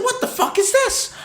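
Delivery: high-pitched mock outrage; incredulous retold outburst. Customer retelling his fight with the cable company, high indignant outburst. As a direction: pitch leaps way up in exasperated disbelief.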